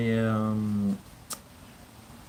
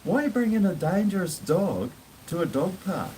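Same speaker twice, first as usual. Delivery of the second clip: high, outraged what the hell man; a retold rebuke to the dog owner; indignant retort; 8 semitones higher than usual. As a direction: challenge the owner in disbelief. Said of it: "I was like".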